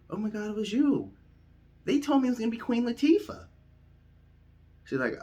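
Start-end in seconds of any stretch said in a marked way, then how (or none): 0.1-3.5 high-pitched, loud, startled protest; flustered astonishment. Retold or imitated. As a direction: blurt out a mock-astonished excuse, voice way up. Retelling his startled reply to Queen.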